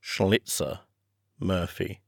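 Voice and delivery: "Schlitzer Murphy" spoken softly, syllable by syllable as SHLIT-ser MUR-fee; name said carefully. Shown as a demonstration. Narrator to listeners, slowly and softly sounding out "Schlitzer Murphy". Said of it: "S C H N L I T Z E R".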